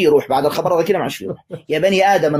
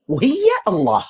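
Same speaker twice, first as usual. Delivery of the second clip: incredulous emphasis; 10 semitones higher than usual. Real, not acted. Ridiculing the verse.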